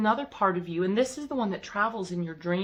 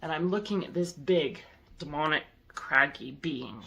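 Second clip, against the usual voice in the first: ordinary delivery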